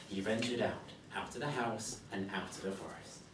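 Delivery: plain reading voice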